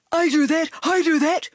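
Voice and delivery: loud eager dog bark. A narrator's reading. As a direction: blurt it out like an excited dog. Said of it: "barked Dog".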